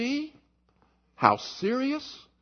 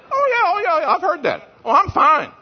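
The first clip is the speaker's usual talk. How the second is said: high, loud brush-off; mocking the self-satisfied member; dismissive; 9 semitones higher, 7 dB louder than usual. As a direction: wave the concern away with mock ease. Voicing a dismissive churchgoer.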